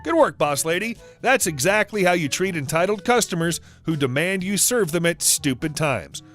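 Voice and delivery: ordinary delivery, nothing marked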